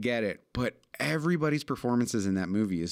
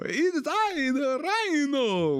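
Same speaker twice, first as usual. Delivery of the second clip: put-on high, slow hammy Russian villain accent; 3 dB louder, slower than usual. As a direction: ham up a silly Russian accent. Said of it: "with that stupid Russian accent".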